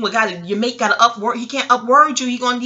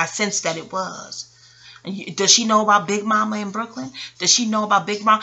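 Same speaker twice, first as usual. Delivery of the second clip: usual speaking voice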